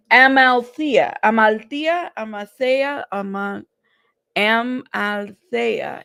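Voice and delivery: trying Amalthea several ways, ending drawn out slowly; effortful attempts at Amalthea; sounding out a name. Real, not acted. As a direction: work through Amalthea, varying the ending between THEE-a, TEE-a and THAY-a. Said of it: "Am I saying it correctly?".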